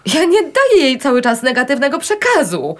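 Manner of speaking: high-pitched, outraged protest; indignant outburst. Acted. Wife denies putting her daughter down, indignant and rising. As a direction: protest hotly, pitch climbing steeply.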